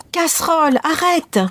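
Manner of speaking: high, rising panic at the pot; panicked cry. A narrator's reading. Mother panics as porridge overflows.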